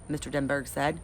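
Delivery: ordinary delivery, nothing marked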